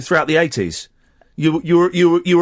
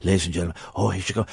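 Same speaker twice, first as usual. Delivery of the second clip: hushed, low TV host voice; Eamon Andrews; 11 semitones lower, 4 dB quieter than usual. Pretend. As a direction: whisper it like a hushed presenter. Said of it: "I'm Eamon Andrews".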